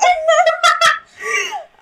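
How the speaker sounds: loud, high burst of laughter. Real, not acted. Cohost's joke lands, loud high laughter. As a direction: crack up loudly.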